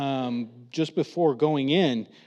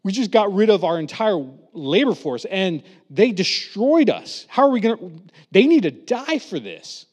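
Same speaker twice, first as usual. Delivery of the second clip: regretful then angry; 5 semitones higher, 7 dB louder than usual. Retold or imitated. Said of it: "the Egyptians are like".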